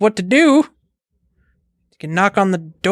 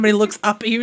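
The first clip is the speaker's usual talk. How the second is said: usual speaking voice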